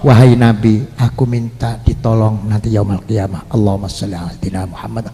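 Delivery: low, soft devotional plea; sholawat penuh rasa. Shown as a demonstration. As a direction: ask humbly, fading into a murmured blessing. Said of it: "Terus sholawat". (memohon lirih dengan nada rendah)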